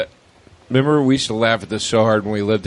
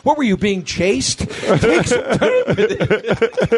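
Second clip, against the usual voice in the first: comedian bit voice; 9 semitones higher, slower than usual. Retold or imitated. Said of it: "and he goes".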